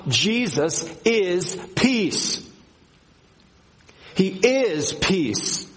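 "Jesus is peace", "He is peace" drawn out, leaning on "is"; slow emphatic declaration. Shown as a demonstration. Pastor naming his first point, slow and emphatic. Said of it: "in the original is emphatic".

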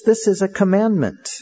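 "This is a commandment" pitched high and insistent; emphatic declaration. Real, not acted. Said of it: "let me emphasize this".

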